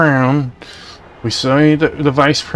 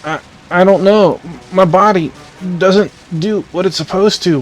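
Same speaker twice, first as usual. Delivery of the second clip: panicked, paralyzed; 5 semitones higher than usual. Acted.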